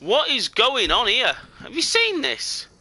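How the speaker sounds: high, drawn-out disbelief; slow incredulous cry, pitch leaping up; astonished. Real, not acted. Gamer to viewers at a strange sight, astonished.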